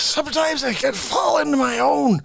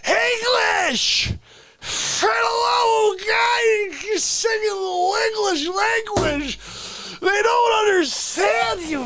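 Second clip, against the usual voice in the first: exasperated yelling; 5 semitones higher than usual. Acted. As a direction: yell at him to speak English.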